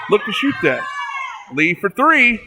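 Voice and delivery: loud shouted call of the tying shot; excited shout. Real, not acted. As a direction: shout it as the ball drops in. Game-tying three pointer call.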